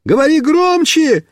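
high calling shout, can't hear. Acted. Mishka running after the car yells back, shouting. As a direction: yell back across the yard. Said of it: "кричит".

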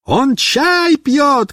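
high, very slow drawn-out yell; shouting. Acted. Mishka yells back about Fedka, shouting. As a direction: call it out long. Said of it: "кричит Мишка".

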